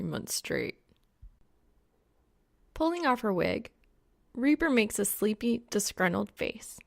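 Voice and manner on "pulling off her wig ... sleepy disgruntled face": ordinary delivery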